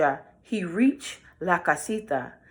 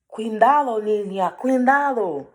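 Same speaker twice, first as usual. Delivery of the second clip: high, louder bird call; warning birds; 5 semitones higher, 4 dB louder than usual. Acted. Birds warning the girl, high urgent call.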